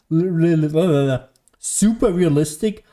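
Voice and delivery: usual speaking voice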